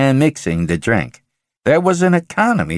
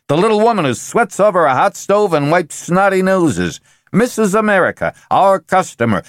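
lightly voiced, higher, pushy executive; pushy salesman Benjamin; 6 semitones higher than usual. Benjamin presses the board to excite housewives, pushy and raised. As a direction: hammer the board with a hard sell, pitched up.

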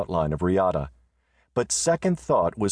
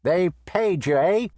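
high, sly, suspicious voice; suspicious junk dealer; 12 semitones higher, 3 dB louder than usual. Acted. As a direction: sneer the question like a miser. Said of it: "said Dickerman".

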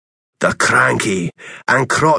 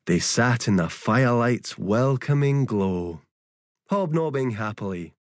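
softer and softer, winding down to sleep; fading bedtime story; 6 dB quieter than usual. A narrator's reading. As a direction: let the story drift off into a hush. Bedtime ending fades to quiet.

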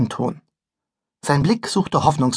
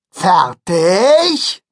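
loud call; 4 semitones higher, 5 dB louder than usual. Acted. Conductor calls again.

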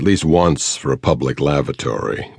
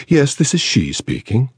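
higher, refined London society voice; a cool, well-bred English lady on the phone; Mayfair accent; 9 semitones higher than usual. A narrator's reading. Actress answering a caller, refined Mayfair accent. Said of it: "The voice was not pitched quite so low".

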